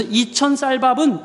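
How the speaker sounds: slow and deliberate. Shown as a demonstration. Preacher showing the child's reading, slow. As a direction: spell out 이천 쌀밥은 slowly. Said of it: "정확하게 해석되는 거예요".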